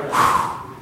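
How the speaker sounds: voiceless puff of breath; blown breath. Retold or imitated. Chaplain testing his breath on a colleague, blown out. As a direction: blow out as if into someone's face.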